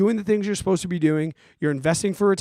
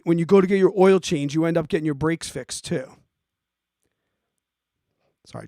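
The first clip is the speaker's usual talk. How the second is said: usual speaking voice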